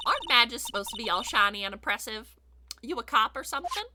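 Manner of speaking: sceptical Southern twang; generic Southern accent. Acted. Photographer doubting the badge, in a Southern accent. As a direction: question them like a wary country gal. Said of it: "doing a very generic Southern accent".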